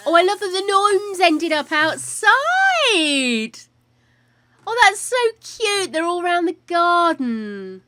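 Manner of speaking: high, loud gushing delight; delighted squeal. Real, not acted. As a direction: gush over something adorable. Delighted at gnomes in garden.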